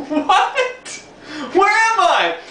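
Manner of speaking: high laughing what, where am I; laughing disbelief. Real, not acted. Narrator amazed at the friendly bus crowd, laughing high.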